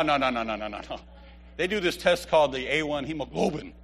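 ordinary talk, nothing marked

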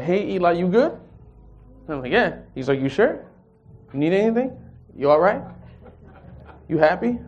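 a lightly voiced eager boss checking in; eager caring boss.

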